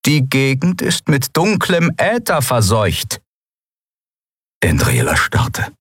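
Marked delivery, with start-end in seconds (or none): 0.1-3.2 slow, high, ominous announcement; grim report. A narrator's reading. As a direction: read out dark news slowly. Grim radio news report. (langsam und unheilvoll verkündet)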